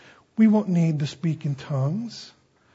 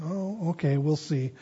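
softer, knowing and unbothered; calm quiet reply; 8 dB quieter than usual. Acted. Jesus calmly answering Peter's boast, softly knowing. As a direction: let the boast go with a gentle shrug.

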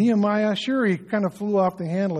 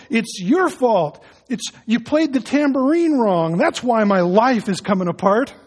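mock blame; 4 semitones higher, 5 dB louder than usual. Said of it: "he, I go".

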